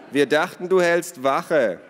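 loud, high teasing call; taunting retort. A narrator's reading. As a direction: shout the jibe back. Teasing shouted retort to Ada.

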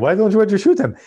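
put-on high-pitched Chechen mobster accent. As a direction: imitate a polite Chechen mobster, pitched well up. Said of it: "he, he has this accent".

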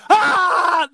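sudden high, loud "Ah!"; mock scream. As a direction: scream like a surprised victim. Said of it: "Hear a scream".

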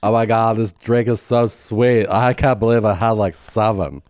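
imitation of a tipsy party girl; mimicking party girls. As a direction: gush with exaggerated, stretched vowels. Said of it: "I love hearing you talk".

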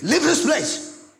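loud, high, drawn-out command; holy anger. Acted. Preacher acts out wisdom as holy anger, shouting angrily. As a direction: order them out with righteous fury. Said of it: "the wisdom will be a holy anger".

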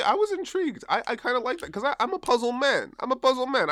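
high, eager puzzle-fan boast; excited. Real, not acted. Excited self-declaration.

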